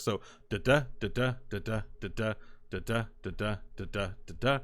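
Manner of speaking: da da chanted in an even iambic beat, weak then strong; iambic rhythm in da syllables. Shown as a demonstration. Podcaster demonstrating meter, chanting the iambic beat. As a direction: tap out the meter on da. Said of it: "just do the syllabic sounds".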